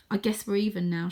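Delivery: usual speaking voice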